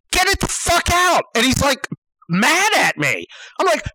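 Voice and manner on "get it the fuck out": high-pitched, fed-up demand; retold exasperated command; angry order. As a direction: order him firmly, voice pitched up. Acted order to drum owner.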